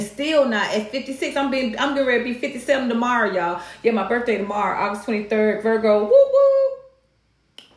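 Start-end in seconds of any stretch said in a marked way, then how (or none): 6.0-7.6 high wailing siren imitation; bullhorn with siren. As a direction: wail like a police siren.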